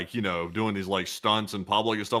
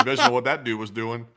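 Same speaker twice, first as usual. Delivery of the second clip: normal talking voice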